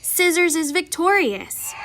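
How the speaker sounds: ordinary delivery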